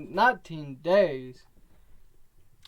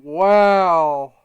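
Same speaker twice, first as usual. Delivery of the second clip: excited wow; 12 dB louder than usual. Real, not acted. Reacts to a high match score.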